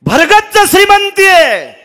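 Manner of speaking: high ringing shout on श्रीमंती; loud sermon cry; preaching shout. Acted. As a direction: cry out the refrain to the crowd. Preacher's refrain in kirtan.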